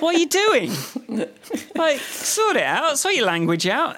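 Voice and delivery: high-pitched comic telling-off; incredulous, laughing protest; mock exasperation. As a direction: scold a whole language in disbelief. Mock telling-off of German speakers.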